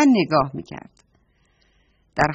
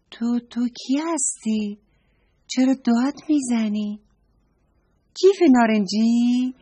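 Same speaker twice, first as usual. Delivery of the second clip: put-on astonished girl; 5 semitones higher than usual. A narrator's reading.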